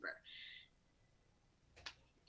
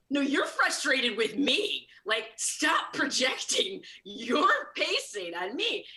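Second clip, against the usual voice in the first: louder, higher, slowed indignant comeback with a laugh; indignant retort; 4 semitones higher, 5 dB louder, slower than usual. Retold or imitated. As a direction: push back hard, half laughing. Arguing back at the therapist.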